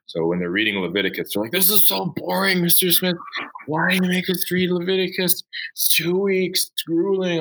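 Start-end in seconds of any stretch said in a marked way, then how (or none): 1.5-7.4 put-on high, whiny teen complaint; whiny students. Teacher mimicking students whining about Leviticus, high and whiny. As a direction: mimic bored freshmen begging off the reading.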